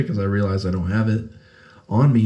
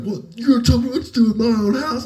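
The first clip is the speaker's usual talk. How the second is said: high, louder imitation of the friend; mock indignation; 12 semitones higher, 5 dB louder, faster than usual. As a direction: ham up his outrage.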